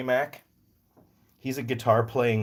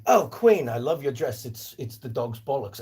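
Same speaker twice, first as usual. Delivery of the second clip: British admirer; 3 semitones higher than usual. Acted. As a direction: flatter the Queen in a put-on British accent. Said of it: "you might say".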